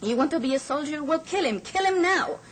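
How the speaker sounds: high, urgent shouted order; shouting commander. A narrator's reading. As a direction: raise the pitch sharply and command. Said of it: "he's shouting".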